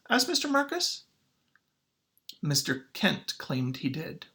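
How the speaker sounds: usual speaking voice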